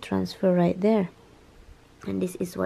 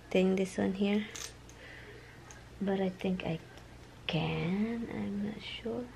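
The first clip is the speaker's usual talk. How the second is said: whispering; 12 dB quieter than usual. Real, not acted. Whispering to not wake.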